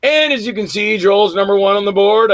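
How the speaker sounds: mock announcement. Wife jokingly announces his ranking.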